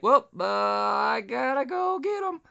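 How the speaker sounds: high, put-on voice for Tony; a hammed-up Tony; Tony, retold. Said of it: "Tony's like".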